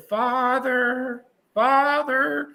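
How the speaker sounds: high, drawn-out mock summons; high singled-out cry for attention, retold; imitated calling out. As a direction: call across the cell block in a high voice. Manson calling out to the chaplain.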